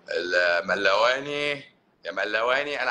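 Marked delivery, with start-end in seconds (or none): none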